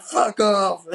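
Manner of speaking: high, loud outburst; hammed-up defiant shout; mock angry yell. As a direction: imitate a teen yelling back at his aunt. Acted quarrel, John talks back.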